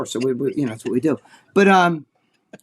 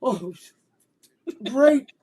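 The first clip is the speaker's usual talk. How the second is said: high, strained mock delight; fake enthusiasm; 6 semitones higher than usual. Guest to hosts, faking delight while hurting. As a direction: pretend to love it while in pain. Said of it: "And I'm like".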